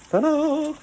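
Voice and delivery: high, bright showman's ta-da; triumphant little fanfare voice; ta-da reveal. Real, not acted. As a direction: present it like a magic trick. Reveals the cleaned toilet.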